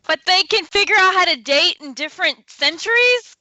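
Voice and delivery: high, loud disbelief; can you believe this; incredulous. Real, not acted. Incredulous rant.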